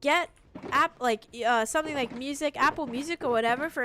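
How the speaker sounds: high, loud mock assistant sales pitch; imitated voice assistant. Streamer mocks her smart speaker pushing products, loud imitation. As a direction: mimic a pushy virtual helper. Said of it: "And she'll say".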